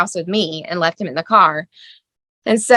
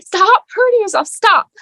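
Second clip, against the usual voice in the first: desperate plea; 14 semitones higher, 4 dB louder than usual. Retold or imitated. Holding son, begging him.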